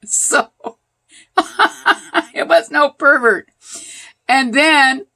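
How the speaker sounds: high, loud, amused through laughter; laughing. Real, not acted. Storyteller reveals there was no pervert, laughing. Laughing at her own punchline.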